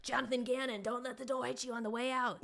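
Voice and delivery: high, put-on Philadelphia accent that slips toward Boston; mock Philly fan accent. Eagles fan jeering the departing coordinator, in a bad Philly accent. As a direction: ham up a Philly fan sending off Gannon. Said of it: "That was not an Eagles accent or Philadelphia accent".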